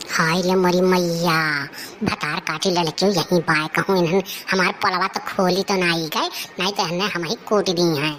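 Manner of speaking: low grumbling crone; grumbling old woman. Acted.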